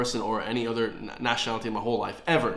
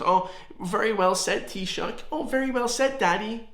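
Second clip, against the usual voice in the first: higher, fawning mock voice; sycophantic journalist; 8 semitones higher than usual. Vlogger mocking the journalist who praised the Taoiseach, higher fawning voice. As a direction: simper it like a brown-noser praising his boss. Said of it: "He said it right there, goes".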